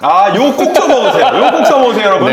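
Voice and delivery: loud laughing encouragement. Real, not acted. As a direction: say it through laughter. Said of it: "laughing loud reply". (웃으며 크게 권하기)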